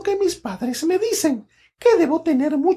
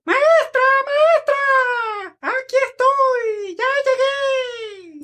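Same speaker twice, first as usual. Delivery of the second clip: excited shout; 7 semitones higher, much slower than usual. Acted. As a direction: call out eagerly, out of breath from running in. Late child calls out arriving.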